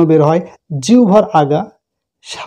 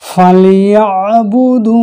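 slow chant stretching one alif; drawn-out recitation; much slower than usual. Shown as a demonstration. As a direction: recite it slowly, holding the vowel. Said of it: "এখানে এক আলিফ টান আছে". (টেনে ধীর তিলাওয়াত)

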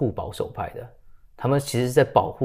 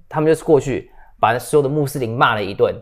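usual speaking voice

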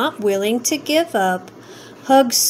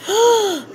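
gasp; 9 semitones higher than usual. A narrator's reading. Dotty reacts with disgust.

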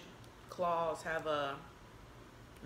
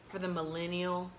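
ordinary talk

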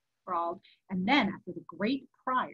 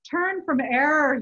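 loud, higher plea from a tormented soul; urgent warning; 6 semitones higher, 9 dB louder than usual. A narrator's reading. Tormented friend warns a sinner to repent, urgent warning. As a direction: exhort forcefully, stretching the first word.

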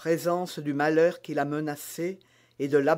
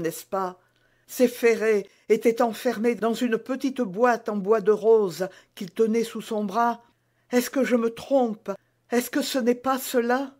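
higher, pressing, rising questions; gently corner the queen with the truth; knowing young maid; 5 semitones higher, 4 dB louder than usual. Acted. Bonacieux révèle le secret. (jeune femme, voix plus aiguë, insistante)

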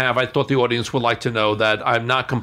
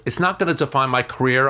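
normal talking voice

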